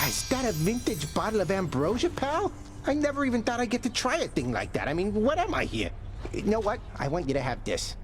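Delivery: excited, high-pitched character voice; overjoyed. Acted. Thanking a pal for the rare bottle, overjoyed.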